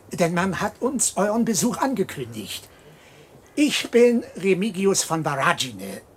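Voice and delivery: normal talking voice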